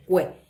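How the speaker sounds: "cue" as one joined syllable, kwe; one unbroken beat on "cue"; no pause. Shown as a demonstration. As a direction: say "cue" without any break in the sound. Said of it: "no tiene esa pausa".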